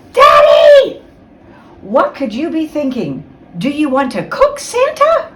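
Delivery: high shocked cry at daddy; outraged shout. Acted. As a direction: scold him loudly, aghast. Olivia scolds father about fire.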